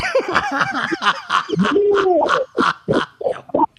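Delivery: long, high peals of laughter; real laughing fit. Real, not acted. Teasing friend about refreshing drink, laughing hard. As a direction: burst out laughing and keep going.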